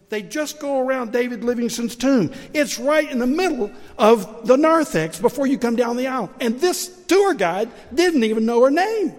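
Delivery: loud, high, indignant; preacher's outraged climax; indignant outburst. Real, not acted. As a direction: raise the voice in disbelief.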